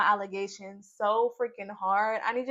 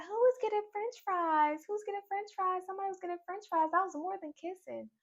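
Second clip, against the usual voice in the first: high squeaky mock panic, much higher; mock Trinity; 10 semitones higher than usual. Vlogger imitating Trinity panicking over french fries, high mock voice. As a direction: play the flustered girl asking around.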